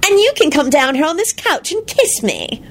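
high-pitched saucy come-on. A narrator's reading. Rita teasing Lupin from the couch, saucily. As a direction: toss it out as a cheeky invitation. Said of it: "Rita told Lupin saucily".

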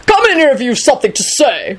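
put-on high-pitched old man's bellow. A narrator's reading. Richard orders him into the room, bellowing. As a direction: call out crossly, pitched high. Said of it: "Richard bellowed".

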